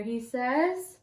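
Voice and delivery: ordinary delivery, nothing marked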